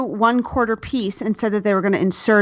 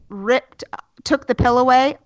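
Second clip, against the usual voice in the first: normal talking voice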